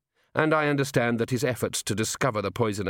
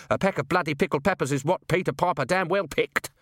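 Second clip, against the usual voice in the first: higher, menacing snarl; aggressive, pitched-up snarl; 6 semitones higher than usual. A narrator's reading. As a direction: punch out the tongue-twister like a threat. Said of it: "he snarled".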